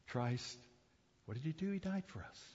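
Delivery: ordinary delivery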